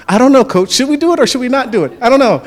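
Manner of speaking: high, loud mock bewilderment; confused players. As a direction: answer the coach in comic confusion. Confused players answer the coach.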